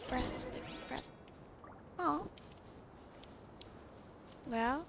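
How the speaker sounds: ordinary delivery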